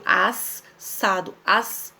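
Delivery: "assado" slowly broken into "as" and "sado"; drawn-out double S in "assado"; both S's sounded. Shown as a demonstration. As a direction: linger on the S closing "as", then restart on "sado". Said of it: "eu pronuncio os dois S's".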